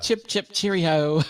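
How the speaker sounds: mock British cheer. Joke after British accent.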